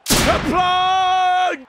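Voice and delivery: high, loud sustained holler; long triumphant yell; goal celebration shout. Real, not acted. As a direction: belt out the player's name. Shouting a player's name in celebration.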